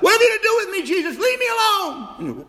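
high, loud anguished cry; retold demonic shriek, very high. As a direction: shriek it like a possessed person. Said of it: "someone cried out".

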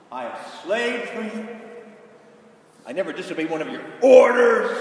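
loud, bitter repeat of the grievance; resentful complaint. Retold or imitated. Resentful son repeats his grievance to his father, bitter and loud. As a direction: throw the complaint back at him. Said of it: "That is not where this son is".

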